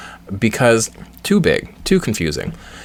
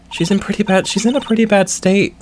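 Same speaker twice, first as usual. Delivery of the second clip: higher, anxious game character; worried character voice; 9 semitones higher than usual. Acted. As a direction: sound worried for your friend.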